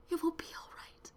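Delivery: soft whispered reassurance. A narrator's reading. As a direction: whisper the comfort under your breath.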